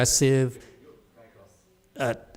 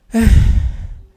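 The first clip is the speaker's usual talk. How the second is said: long loud unvoiced sigh; 9 semitones higher, 11 dB louder than usual. Real, not acted. Teacher letting go of the rant with a big sigh. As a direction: heave a big resigned sigh.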